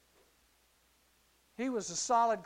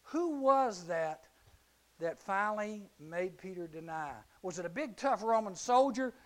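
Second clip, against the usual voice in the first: ordinary talk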